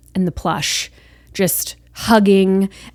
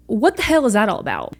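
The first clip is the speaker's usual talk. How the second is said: usual speaking voice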